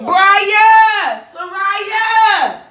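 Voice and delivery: loud call across the house; calling out names. Real, not acted. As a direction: shout their names to find them. Calling for missing kids.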